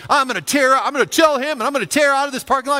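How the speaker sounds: loud, high, fast bravado; cocky driver. Retold or imitated. Driver imagining his grand exit, loud and fast. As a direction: rush it like a hothead planning his exit. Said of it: "he was thinking".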